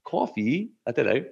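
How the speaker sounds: put-on a tentative Dutch accent on coffee. Asked for his best Dutch accent, a hesitant Dutch accent. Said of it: "Do your best Dutch accent".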